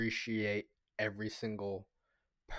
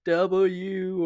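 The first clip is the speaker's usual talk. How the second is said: Rizzler's W; 7 semitones higher, 5 dB louder than usual. Retold or imitated. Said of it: "the way he says".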